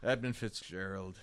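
soft, low, halting storytelling; low-energy old singer. Retold or imitated. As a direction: imitate a fading elderly performer rambling. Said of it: "like, low energy".

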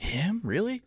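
put-on high, incredulous kid voices; kids' disbelief. Teammates hearing he can throw, in high astonished disbelief. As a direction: act the stunned teammates, way up in pitch.

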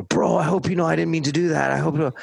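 high, fast sheepish pleading; mock apologetic bro, fast and high. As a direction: imitate the bro begging forgiveness. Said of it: "the guy keeps apologizing".

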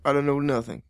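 low mumbling tough guy. Acted. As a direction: mumble like a wary informant. Same put-on voice.

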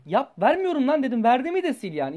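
yüksek sesle, öfkeyle bağırarak anlatıyor; otobüste çocuğa çıkışmayı canlandırarak, sesini yükselterek; retold yelling. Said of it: "tramvayda bir bağırmışım". (öfkeli bir bağırışın canlandırılması)

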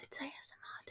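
very soft whisper. Pretend. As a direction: barely whisper it. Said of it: "start to whisper".

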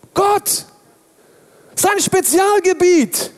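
high, ringing proclamation of God; triumphant exclamation. Real, not acted. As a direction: shout it out in triumph, slowly. Triumphant answer to own question. (triumphierend, hoch und langsam ausgerufen)

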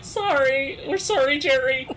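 high, loud put-on apology; apologetic interns. Interns saying sorry to Jerry as they betray him, high mock apology. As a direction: pitch it way up, a mock-sorry little chorus.

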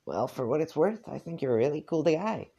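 put-on a friendly kid paying a compliment; a lightly voiced young John; John's voice. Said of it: "I had a John voice and I lost it".

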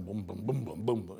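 low, muted bass thumps; imitated distant booming. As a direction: boom it deep, like a car stereo down the street. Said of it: "you hear 'em coming".

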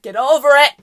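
loud burst of exasperation; exasperated yell. Retold or imitated. Onlooker fed up with girls still complaining, exasperated yell. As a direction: suddenly belt it out, fed up.

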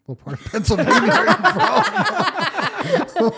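asked through real laughter, high and slow; can't stop laughing while asking; giggling question, slow and high. Real, not acted. Asks while laughing hard.

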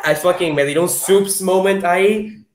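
ordinary talk

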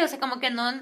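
ordinary delivery

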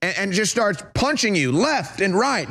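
ordinary talk

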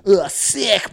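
high, loud retold reaction; hammed-up outburst; mock exclamation. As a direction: blurt it at the screen. Reacting aloud to the film.

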